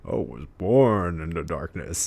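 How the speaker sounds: lightly voiced Bane, slow; slow hint of the Bane villain. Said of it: "Bane from Batman".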